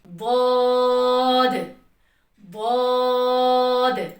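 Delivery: द्वाद stretched long and full, loud, tongue edge on the molars; द्वाद drawn out slowly, heavy and loud; heavy drawn-out dwad. Shown as a demonstration. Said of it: "सबसे ज्यादा पुर पढ़ा जाने वाला हरफ".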